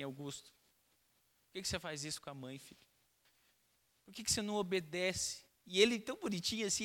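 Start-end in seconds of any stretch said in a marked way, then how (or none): 0.0-5.4 soft, tearful pleading; mom crying. Retold or imitated. Mother pleading softly with her toddler, tearful. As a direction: plead with him softly, near tears. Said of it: "Ela começou a chorar".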